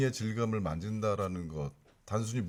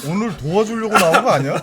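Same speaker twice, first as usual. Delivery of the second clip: mock scolding; 9 semitones higher, 13 dB louder than usual.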